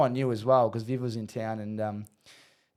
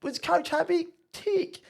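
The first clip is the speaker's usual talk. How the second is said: very high singled-out questions; high comic coach impression; imitating the coach; much slower than usual. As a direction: tick off a checklist in a squeaky coach voice. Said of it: "He goes".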